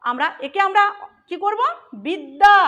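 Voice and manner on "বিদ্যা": বিদ্যা said loud and rising, to draw attention; বিদ্যা hit loud as a teaching cue; pointing out a word part. Shown as a demonstration. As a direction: call out বিদ্যা with a rising tune. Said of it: "এই আটা কোথায় এসছে".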